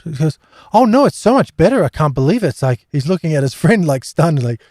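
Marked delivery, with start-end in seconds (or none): 0.7-2.5 amazed. Retold or imitated. Healed man reacts in amazement.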